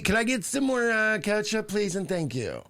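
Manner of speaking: high, prissy voice asking a waiter for ketchup; mock a snob ordering ketchup at a restaurant; snobby customer. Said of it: "a snobby person would say to a waiter".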